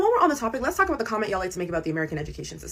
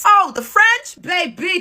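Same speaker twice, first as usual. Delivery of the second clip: much higher, louder, rising scoff; mock disbelief, pitched way up; incredulous exclamation; 11 semitones higher, 10 dB louder, much slower than usual. Real, not acted. Scoffing at French commenters, an incredulous high exclamation.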